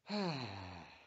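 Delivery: long, loud falling sigh. A narrator's reading. Tammy grudgingly thanks Louise, loud sigh. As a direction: let out a heavy, drawn-out sigh. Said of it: "with a sigh".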